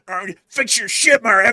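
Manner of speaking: high, loud yappy outburst; snarling Chihuahua person. Acted. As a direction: snap like a tiny dog under a desk. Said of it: "the Alabamians would be like the Chihuahuas".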